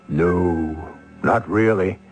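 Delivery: ordinary delivery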